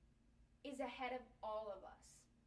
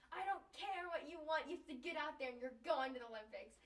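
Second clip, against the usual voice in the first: hammed-up bossy mom, louder and higher; pushy soccer mom; 5 semitones higher, 8 dB louder than usual. Daughter mocking an imagined crazy soccer mom, loud and bossy. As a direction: play a pushy sports mom barking orders. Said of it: "a crazy soccer mom".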